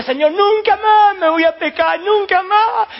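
high, loud, slow declaration; proud vow. Acted. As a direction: swear it like nothing could make you fall. Boastful vow of self-sufficiency.

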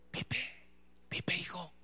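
hushed, whispered wake-up call; mamá cariñosa. Retold or imitated. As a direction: whisper it softly, like a mother waking her child. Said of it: "¿cómo te habla tu mamá?". (susurro suave de mamá)

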